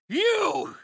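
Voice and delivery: high, slow, drawn-out yell; outraged shout. Acted. Villain struck in fight blames Osborn, outraged high shout. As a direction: cry out in fury, stretching the words.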